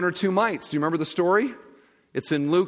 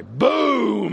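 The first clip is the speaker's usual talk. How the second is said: imitated boom; 7 semitones higher, 5 dB louder than usual. Coin bag dropped in box.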